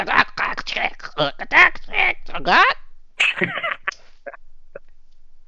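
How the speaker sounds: high unvoiced goblin chatter; goblin chittering. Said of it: "sounds like someone's throttling seagulls".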